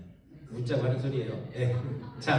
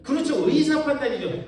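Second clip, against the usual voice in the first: laughing agreement; 7 semitones higher, 6 dB louder than usual. Real, not acted. Laughing affirmation to congregation.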